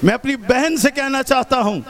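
ordinary talk, nothing marked

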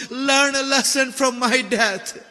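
ordinary talk, nothing marked

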